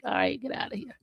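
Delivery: lightly voiced son shooing her off; imitating her son. Mother imitating her son dismissing her after the hugs. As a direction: brush her away playfully as the boy. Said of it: "Then he'll be like".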